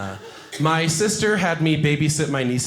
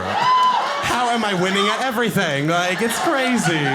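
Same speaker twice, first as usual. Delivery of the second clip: gleeful boast; 7 semitones higher than usual. Retold or imitated. Boasting at mirror.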